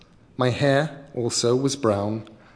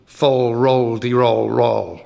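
troll afterthought; 5 dB louder, slower than usual. Acted. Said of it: "paused and added, more or less as an afterthought".

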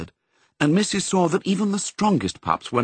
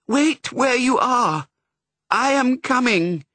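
a much higher, slow, stranger's voice; answering bark voice; 13 semitones higher, 3 dB louder, slower than usual. A narrator's reading. A strange dog answering Pongo's bark, high and slow. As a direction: call back across the fields, slow and high.

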